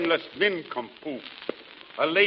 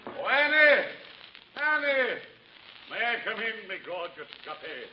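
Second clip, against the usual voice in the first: much higher, theatrical calling husband; high pompous suitor; 8 semitones higher than usual. Acted. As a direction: call out like a fussy gentleman. Husband calls for Annie.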